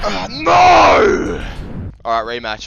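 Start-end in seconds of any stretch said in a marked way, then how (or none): 0.0-1.4 loud, high cry of defeat; frustrated shout. Real, not acted. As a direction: yell as the fight is lost. Losing the fight, shout heard.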